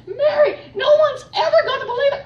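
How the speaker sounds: high, loud laugh-crying shout. Retold or imitated. Friend crying out at the angel, laughing and tearful. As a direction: cry out half laughing, half weeping.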